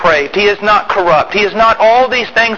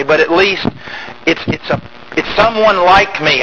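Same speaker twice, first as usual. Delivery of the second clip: ordinary delivery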